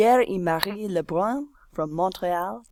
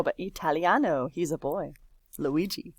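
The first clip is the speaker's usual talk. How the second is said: ordinary talk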